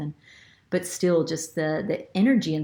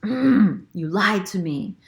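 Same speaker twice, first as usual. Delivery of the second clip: slow, louder, accusing outburst; wounded ego's accusation; 6 dB louder, slower than usual. Retold or imitated. To the deceiving ex, slow louder accusation. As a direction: spit it out like a betrayed ego. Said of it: "the egoistic part of me feels".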